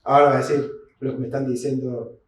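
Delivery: usual speaking voice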